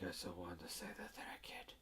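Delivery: hushed, breathy confession; secret whisper. Retold or imitated. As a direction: whisper it like a secret nobody may hear. Girl confides a secret.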